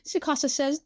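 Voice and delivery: ordinary delivery, nothing marked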